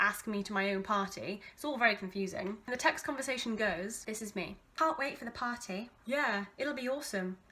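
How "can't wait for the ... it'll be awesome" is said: usual speaking voice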